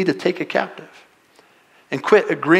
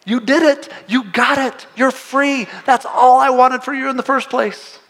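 high, celebrating encouragement; God throwing a party for the forgiven; joyful God; 8 semitones higher than usual. Retold or imitated. God rejoicing over a freed believer, high voice. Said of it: "He's like".

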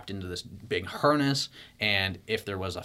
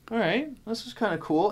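casual self; 12 semitones higher than usual. Retold or imitated. Acting his casual self.

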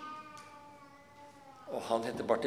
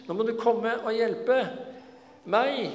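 much higher, louder desperate cry; calling out; 11 semitones higher, 5 dB louder than usual. Acted. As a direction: shout for help over the crowd. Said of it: "men han bryr seg ikke og roper".

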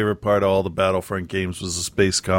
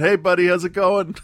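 bright, higher call to a dog; higher, friendly hello to a buddy dog; cheery dog greeting; 8 semitones higher than usual. Retold or imitated. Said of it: "waved to him and went".

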